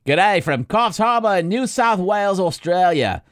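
reading the fan's email as an Aussie, badly; bad Australian accent. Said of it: "butchered his, uh, homeland, his accent".

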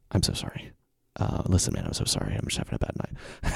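low, soft, sheepish retreat; retold contrite mumble; hushed apology. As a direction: back down at once, almost whispering. Immediate apology after losing temper.

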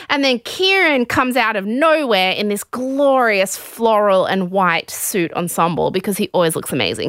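ordinary talk, nothing marked

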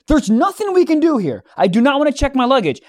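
high-pitched exasperated protest; retold arguing at full volume. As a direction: argue it so bystanders overhear. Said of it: "You were yelling that loud".

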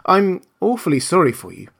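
put-on high-pitched girl's sympathy; sympathetic girl. A narrator's reading. Girl pities the scarecrow.

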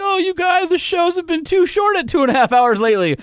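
high mocking complaint from a fan; mock whiny listener. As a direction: whine it like a sarcastic listener. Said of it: "everybody who's been like".

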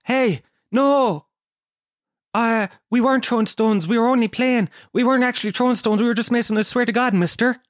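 high-pitched pleading schoolboy voice; pleading boy. Acted. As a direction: protest innocence in a high, pleading voice. Boys plead with angry man.

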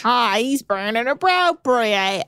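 slow, failing Kiwi pirate impression; drawn-out mock New Zealand pirate; New Zealand pirate accent. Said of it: "New Zealand pirate accent".

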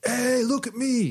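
high, slow showy call; show-off. Retold or imitated. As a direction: stretch it out like a show-off. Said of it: "being like".

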